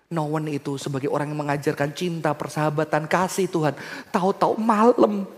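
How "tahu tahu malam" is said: loud, high climactic sermon voice; preacher voicing a lonely friend's call; fervent preaching. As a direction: build to the lonely plea.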